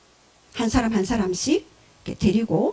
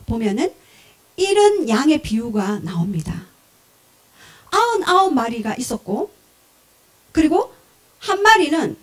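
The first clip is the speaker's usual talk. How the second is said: usual speaking voice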